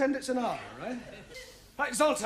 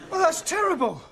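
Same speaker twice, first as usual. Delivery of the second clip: shocked; 6 semitones higher, 4 dB louder than usual. Real, not acted. Teacher shocked by news.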